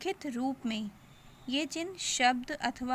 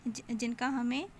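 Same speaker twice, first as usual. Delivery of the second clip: ordinary talk, nothing marked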